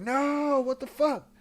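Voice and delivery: high, loud exasperated protest; mock outrage. Road manager protesting as X gets on the bike. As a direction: cry out in disbelief. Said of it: "And Poe's like".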